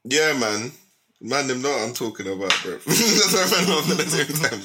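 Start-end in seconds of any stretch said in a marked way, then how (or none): 2.9-4.6 laughing. Real, not acted. Laugh on these words.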